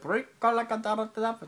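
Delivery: high, slow drawn-out Ricola; hammed-up Ricola call; mock Swiss herb call. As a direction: stretch Ricola like the ad jingle. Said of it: "she's like".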